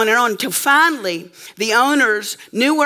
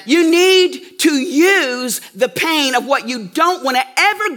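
preaching; 4 semitones higher than usual. Acted. Preacher urging the congregation to use pain, full force. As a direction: drive the lesson home to the congregation.

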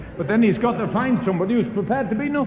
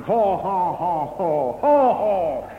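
guffaw at her like rough workmen; mock raucous laughter; 3 semitones lower, much slower than usual. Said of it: "they started laughing".

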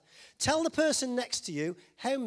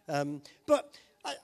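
normal talking voice

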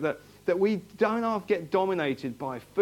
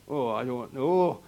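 put-on lower-pitched stab at a northern English accent; half-hearted northern English accent; attempted northern English accent; 4 semitones lower than usual. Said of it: "I can't do a northern accent".

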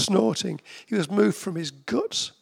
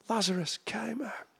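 half-whispered, much softer; hushed awe; 2 semitones higher, 9 dB quieter than usual. Real, not acted. Preacher marvelling at the raising of Lazarus, hushed awe. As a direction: let it drop to an amazed near-whisper.

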